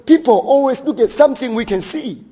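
loud, high fervent sermon; preaching at full force. Acted. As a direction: rouse the congregation to look for purpose.

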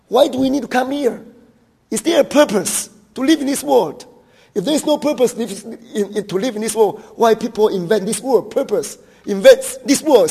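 loud, high fervent sermon; preaching at full force. Acted. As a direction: rouse the congregation to look for purpose.

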